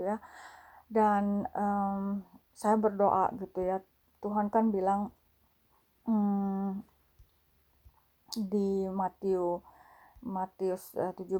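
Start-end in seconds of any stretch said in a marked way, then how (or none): none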